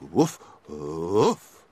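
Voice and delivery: low, slow dog voice; lightly voiced dog. A narrator's reading. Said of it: "said Dick the dog".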